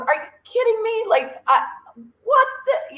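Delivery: sharply high-pitched, acted incredulity; Santiago's disbelief. Speaker voices beaten man laying there, mock disbelief. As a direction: sputter in mock outrage, breaking off.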